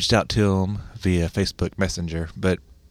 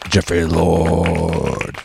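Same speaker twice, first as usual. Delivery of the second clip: announcer voice; 2 semitones lower, 4 dB louder, much slower than usual. Acted. Host announces own name dramatically.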